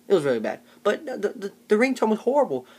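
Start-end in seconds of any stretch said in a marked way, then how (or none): none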